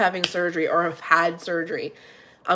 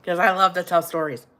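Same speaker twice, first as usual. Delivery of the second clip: ordinary delivery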